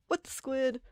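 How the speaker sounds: playful mock of her kid self; childhood Squid Girl catchphrase. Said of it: "I would speak like Squid Girl from the anime".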